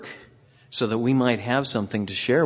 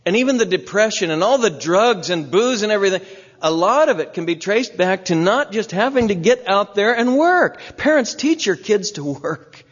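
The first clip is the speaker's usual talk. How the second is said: rising preaching emphasis; 7 semitones higher than usual. Real, not acted. Preacher building to a climax.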